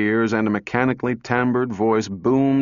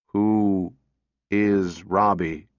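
low, very slow, toneless machine voice; flat robot voice; 3 semitones lower, much slower than usual. A narrator's reading. Robot answering a little girl, flat and mechanical. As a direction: drone it out like a talking machine.

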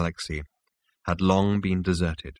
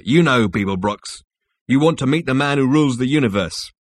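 louder, emphatic character voice; Rooster insistent; 5 semitones higher, 6 dB louder than usual. Acted. Rooster confronts Zaphod with what he wants, loud and insistent. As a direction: press the point home forcefully.

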